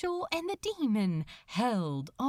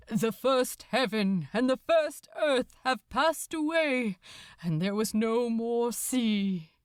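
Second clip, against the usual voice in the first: solemn scripture intoned over the crowd; a priest reciting Revelation, steady and grave; intoning priest. Acted.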